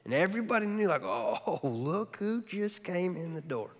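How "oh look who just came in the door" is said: hushed, breathy snide whisper; gossiping whisper. Retold or imitated. Party guests whispering about the woman at the door, snide hush.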